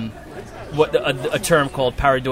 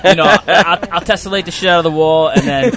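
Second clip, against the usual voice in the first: louder, faster, laughing; excited chatter; 5 semitones higher, 10 dB louder, faster than usual. Real, not acted. Artist joking about his murals to the hosts, loud and laughing. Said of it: "my Long Island accent coming out".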